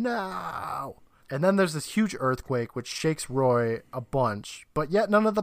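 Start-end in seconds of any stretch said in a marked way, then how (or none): 0.0-1.0 higher, softer mock scream; retold scream. Jamie as the doors slam behind the brother, mock scream. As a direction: draw out a held-back mock scream. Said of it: "And Jamie's screaming".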